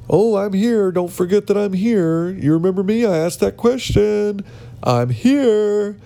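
high-pitched mock eager candidate; attention-seeker. Candidate one-upping everybody at the interview, mock attention-seeking.

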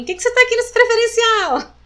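high, accusing question in a stranger's voice; imitating strangers challenging her in the priority seat; suspicious strangers. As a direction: mimic people doubting her right to sit there.

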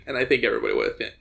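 ordinary delivery

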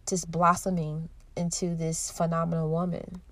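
usual speaking voice

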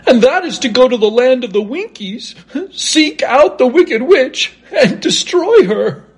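high, quavering lion voice; cowardly lion. A narrator's reading. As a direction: pitch it way up as the timid lion. Voices the lion urging the quest.

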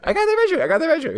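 real excitement at getting the reference; excited pride. Real, not acted. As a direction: crow about solving it. Proud of catching the reference.

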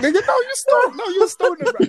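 high-pitched teasing outburst; mock exclaiming. Friends reacting to a first-time smoker, high mock disbelief. Mocks the newly stoned friend.

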